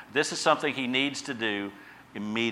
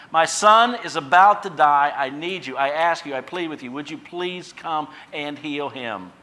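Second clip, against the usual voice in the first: pleading father; 6 semitones higher, 9 dB louder than usual. Acted. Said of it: "He tells him exactly".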